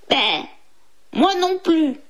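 bêlement bref, puis réplique plus haute; bêler puis renchérir en chèvre; chèvre qui renchérit. A narrator's reading. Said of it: "renchérit la chèvre". (bêlement de chèvre et petite voix haute)

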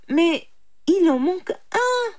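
high-pitched pleading of a poor man; imploring. A narrator's reading. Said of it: "implora le pauvre homme". (supplication aiguë)

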